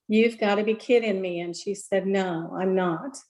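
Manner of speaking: ordinary talk, nothing marked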